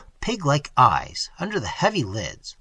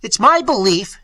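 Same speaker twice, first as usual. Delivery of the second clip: loud, higher shout; 7 semitones higher, 7 dB louder than usual. A narrator's reading. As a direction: shout the accusation. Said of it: "shouted Quint".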